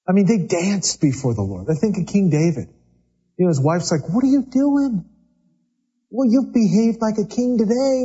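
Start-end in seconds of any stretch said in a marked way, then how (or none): 4.1-8.0 mocking, higher voice as the scornful wife. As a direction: sneer at him like a jealous wife. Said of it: "she's mocking him".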